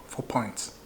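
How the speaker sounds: hushed whisper of the number. Real, not acted. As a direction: whisper it like a secret. Hammering point to audience.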